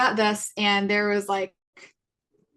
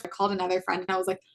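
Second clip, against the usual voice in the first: normal talking voice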